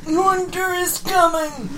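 slow, high, grand pronouncement; dramatic quote. Pretend. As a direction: intone it like an ominous prophecy. Quoting famous line dramatically.

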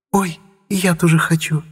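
high, thrilled little squeal; delighted. A narrator's reading. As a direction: burst out with childlike delight. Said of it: "Эльфи пришла в восторг".

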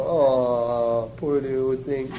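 low, soft, soothing murmur; tender cooing sympathy. Acted. Preacher acting someone holding a crying baby, tenderly comforting. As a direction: coo gently as if cradling a baby. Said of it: "Normally you sit there and go".

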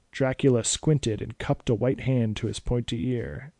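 ordinary delivery

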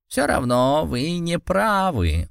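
slow, high stubborn cat snort; stubborn grumble. Acted. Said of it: "упрямо профырчал Саранеко".

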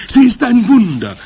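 very high, loud crowd cry; adoring scream. A narrator's reading. Witch audience hailing their leader, adoring scream. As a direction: scream it in wild admiration. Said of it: "schrie das Publikum".

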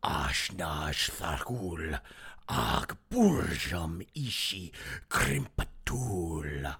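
very low, slow, hushed incantation; dark tongue of Mordor. Acted. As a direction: intone it deep and drawn out, like dark speech. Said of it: "the dark tongue of Mordor".

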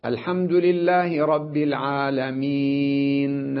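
slow chanted recitation, العالمين ending in a faint, stolen kasra on the ن; stopping with rawm. Shown as a demonstration. Teacher demonstrating rawm to students, slow chanted recitation. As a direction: chant it slowly and let the ن of العالمين barely hint its vowel. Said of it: "أقول هكذا".

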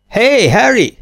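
high, loud summons; calling out. A narrator's reading. Captain summoning his ship's officer, calling out. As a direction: holler for someone in another room.

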